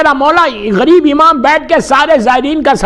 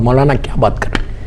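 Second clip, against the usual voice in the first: usual speaking voice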